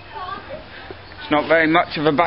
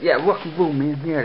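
ordinary delivery

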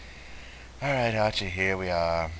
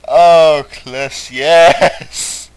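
mock ecstatic cry; 5 semitones higher, 9 dB louder, much slower than usual. Mock ecstasy naming Cliss.

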